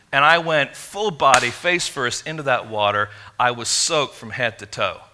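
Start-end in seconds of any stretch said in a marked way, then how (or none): none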